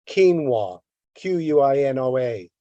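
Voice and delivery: ordinary talk, nothing marked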